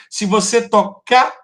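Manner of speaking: "tocar" with its last syllable punched high and loud; stress on strong syllable. Shown as a demonstration. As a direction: stop on the rising stressed end of "tocar". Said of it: "eu conto até a sílaba forte".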